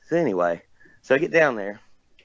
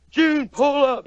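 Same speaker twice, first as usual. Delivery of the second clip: high, raised calling voice; much slower than usual. Retold or imitated. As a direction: call out to someone who can't hear. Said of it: "She couldn't hear him".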